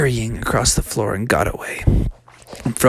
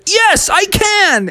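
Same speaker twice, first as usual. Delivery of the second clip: much higher, slow proud answer; triumphant; slower than usual. Acted. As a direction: stretch it out like a hero. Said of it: "He's like".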